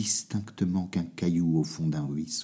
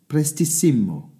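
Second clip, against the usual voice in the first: calling out; 6 semitones higher, 3 dB louder than usual. A narrator's reading. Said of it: "cria la voix".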